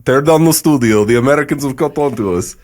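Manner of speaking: hammed-up Russian accent, loud; bad Russian accent. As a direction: play a cartoon Russian spy in a bad Russian accent. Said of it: "in the worst Russian accent you've ever heard".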